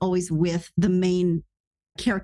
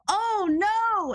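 mock panic; 11 semitones higher than usual. Retold panic at the revisions.

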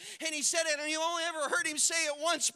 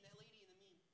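normal talking voice

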